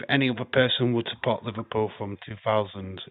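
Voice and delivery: ordinary delivery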